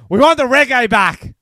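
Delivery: high, loud playful shout; mock demand. Co-host demanding the recurring bit back, hammed-up high shout. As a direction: call it out like a rowdy crowd.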